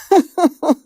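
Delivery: short bright burst of laughter; delighted laugh. Real, not acted. Therapist delighted by the client's breakthrough, laughing. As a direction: let a happy laugh escape.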